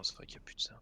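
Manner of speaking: breathy whisper; whispered aside. Real, not acted. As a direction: say it under your breath.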